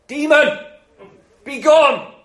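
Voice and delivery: loud, high commanding cry; mock exorcism shout. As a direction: order the demon out with theatrical force. Disciples acted commanding a demon.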